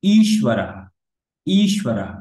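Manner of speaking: ঈশ্বরः as "eesh-wa-ra-ha", long first vowel; careful model pronunciation. Shown as a demonstration. Teacher repeating on a learner's request, careful ঈশ্বরः. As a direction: lean on the ঈ of ঈশ্বরः, breathe out the final h. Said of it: "ভালো করে শুনুন".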